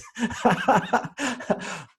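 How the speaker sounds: a sudden bright laugh at the daughter's question; high burst of laughter. Real, not acted. Father laughing about his daughter's sharp question, laughing.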